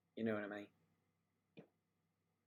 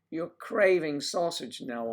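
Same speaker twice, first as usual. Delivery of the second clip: slow louder teasing jab; 4 semitones higher, 8 dB louder, slower than usual. Real, not acted. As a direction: needle him, drawn out. Teasing a guest.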